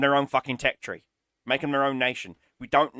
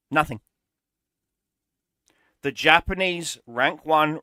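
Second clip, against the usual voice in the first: normal talking voice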